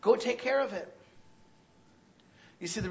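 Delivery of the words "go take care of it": louder, higher, pressing questions; the Lord speaking. Acted. Preacher voicing the Lord confronting the congregation, loud and pressing. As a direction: challenge them, rising and insistent. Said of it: "What else does he say".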